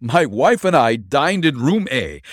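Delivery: loud eager telling; eager rush. A narrator's reading. Prisoner eagerly explaining to detective, loud. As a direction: pour it out eagerly.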